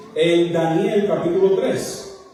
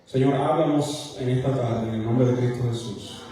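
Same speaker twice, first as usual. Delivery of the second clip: prayer; 5 semitones lower than usual. Acted. Opening prayer before sermon.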